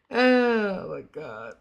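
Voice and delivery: drawn-out, just-woken Oh my God; sleepy sigh of the words; sleepy groan. Real, not acted. Streamer waking up, greeting early chat, with a sleepy drawn-out groan. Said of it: "I'm still rubbing my eyes".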